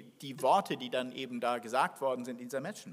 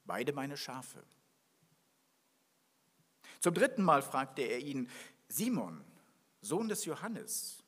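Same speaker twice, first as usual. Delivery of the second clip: scripture reading; 2 semitones lower than usual. Acted. Preacher reading the gospel to his congregation, solemn and measured. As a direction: read it out to the congregation, measured and grave. Reads scripture before the sermon.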